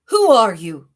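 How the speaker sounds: very loud shout; sudden loud roar of a question; lion yelling. Acted. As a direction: bellow it at the intruder. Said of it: "the lion yelled at my father".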